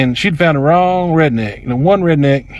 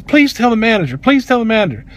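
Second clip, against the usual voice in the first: higher-pitched pleading, mildly emotional; emotional plea; 6 semitones higher than usual. Retold or imitated. Emotional associate begging customer, imitated plea. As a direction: repeat it as a mild imitated plea. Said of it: "She gets even more emotional than this girl".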